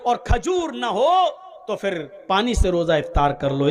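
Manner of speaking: ordinary talk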